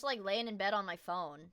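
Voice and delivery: usual speaking voice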